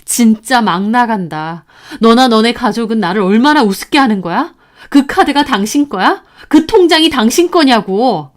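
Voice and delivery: loud, furious accusing wife; wife shouting at her husband over the stolen card; angry confrontation. Acted.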